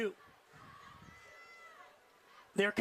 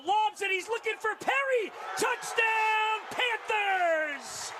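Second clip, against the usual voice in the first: touchdown call; 6 semitones higher, slower than usual. Real, not acted. Touchdown call, rising excitement.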